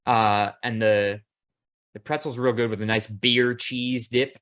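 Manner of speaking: normal talking voice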